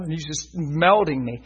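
normal talking voice

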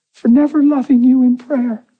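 high, emotional confession; high-pitched retold plea; imitated tearful confession. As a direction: act out a wife confessing through tears. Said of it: "she's bawling".

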